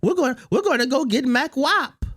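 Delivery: lightly high, mock-obedient; a hint of a groveling yes-man; fawning agents. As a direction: play the FBI as a fawning fan. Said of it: "they're gonna say".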